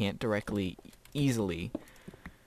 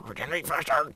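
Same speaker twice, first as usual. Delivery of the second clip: urgent plea; 7 semitones higher, 4 dB louder than usual. Retold or imitated. Player complaining about slow healing.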